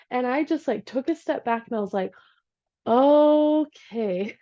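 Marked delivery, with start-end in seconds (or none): 2.9-4.4 long, higher, louder "okay"; slow dawning okay, held long; drawn-out realization. Retold or imitated. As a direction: stretch it out as if taking stock. Stepping back from the chaos, acted.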